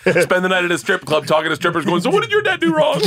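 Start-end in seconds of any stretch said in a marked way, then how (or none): 2.0-3.0 much higher, louder hammed-up shout; mock shouted question. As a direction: yell it in a shrill mock voice.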